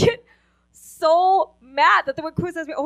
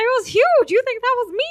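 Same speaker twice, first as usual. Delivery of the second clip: high-pitched indignant disbelief; indignant protest; 9 semitones higher, 5 dB louder than usual. Retold or imitated. Daughter defending herself to mother, indignant protest. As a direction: protest high and loud in mock outrage.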